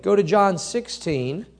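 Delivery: normal talking voice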